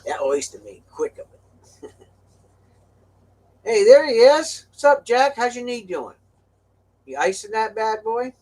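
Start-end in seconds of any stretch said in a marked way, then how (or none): 3.6-8.3 high, loud friendly call; hearty greeting. Real, not acted. Trucker greeting his friend Jack, high and loud. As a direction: hail a buddy.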